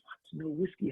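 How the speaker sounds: soft, stunned disbelief; hearing there will be no whiskey, stunned and soft; stunned hush. Acted. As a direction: barely get the words out. Chaim hears no whiskey.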